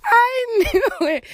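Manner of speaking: high, loud, slow laughing cry; high laughing gloat. Real, not acted. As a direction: wail it through laughter. Laughing triumph.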